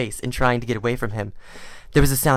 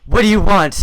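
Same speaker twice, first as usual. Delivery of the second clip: higher, louder angry demand; furious; 7 semitones higher, 9 dB louder than usual. A narrator's reading. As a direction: bark the question. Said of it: "he asked furiously".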